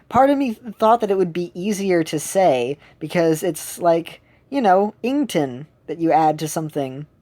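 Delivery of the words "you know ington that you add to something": ordinary delivery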